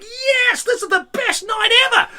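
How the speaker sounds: high, loud gleeful shout; the room cheering his misfortune; triumphant cheer. Retold or imitated. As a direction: celebrate wildly. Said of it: "we were like".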